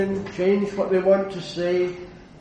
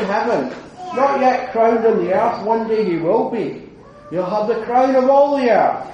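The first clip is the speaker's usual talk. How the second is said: louder and higher, building in praise; rising preaching fervour; 4 semitones higher, 6 dB louder than usual. Real, not acted. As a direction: preach it out as a triumphant proclamation. Preacher's climax on Christ's crown.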